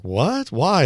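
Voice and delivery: startled high what, why; shocked teen asking his dad why; surprised. Retold or imitated. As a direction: blurt it out in confusion. Confused teen questioning his dad.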